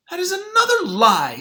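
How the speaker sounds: high, loud indignant yell; wanting to yell. Acted. Sylvia wanting to yell at the lying superintendent, loud outraged cry. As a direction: shout it out at the liar. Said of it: "Sylvia wanted to yell".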